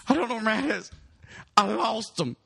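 imitated panic, borderline crying; very high, frantic mock wail; Joey. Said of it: "like, borderline crying and shit".

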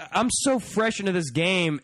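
ordinary delivery

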